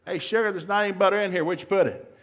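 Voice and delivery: a clueless husband shouting from the fridge; husband calling out. Retold or imitated.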